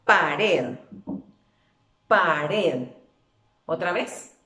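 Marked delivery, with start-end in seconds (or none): 0.0-2.9 "pared" loud and lower, weight on "red"; stress on last syllable. Shown as a demonstration. Teacher leading pronunciation practice, "pared" stressed on "red". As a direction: hit the final "red" of "pared". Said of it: "Pronunciemos la palabra".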